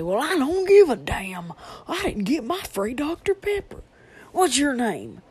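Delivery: loud, high, entitled customer complaint; angry customer. Retold or imitated. Imitating a rude customer demanding her free soda, loud and high. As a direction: snap at the cashier over a missing freebie.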